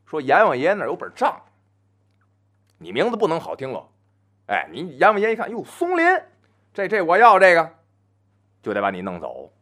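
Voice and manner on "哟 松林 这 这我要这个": higher, pleased exclamation as the king of hell; delighted king of hell picking a name; mock Yama. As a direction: underworld king lightly voiced, pleased. Yama spots a nice name.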